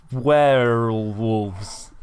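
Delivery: very slow, stretched-out reveal; drawn-out. Real, not acted. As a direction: stretch the word right out. Drawn-out punchline reveal of pun.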